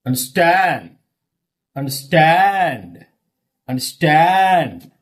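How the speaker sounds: "And stand" with STAND long, strong and falling; brief first part, then stretch STAND; stress on second part. Shown as a demonstration. Teacher demonstrates compound verbs, final STAND stressed. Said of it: "Stress fall on second syllable".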